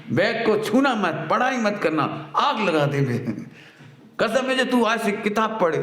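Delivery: mock angry mother. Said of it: "जब माँ जोर से गुस्सा में बोलती है".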